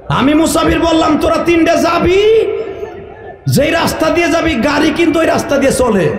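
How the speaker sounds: calling out. Real, not acted. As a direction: stop them from leaving. Calls out leaving listeners.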